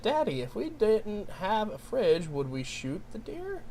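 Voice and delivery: a hint of a child asking Daddy; softly trailing child's question, lightly imitated; lightly voiced son. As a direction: imitate your kid's slow, curious question. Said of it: "my son said".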